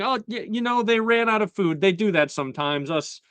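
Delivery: high-pitched put-on character voice; Tangletongue. Underling explains to the annoyed king about food, high put-on voice. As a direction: lightly imitate a hapless underling, pitched well up. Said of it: "he is told by Tangletongue".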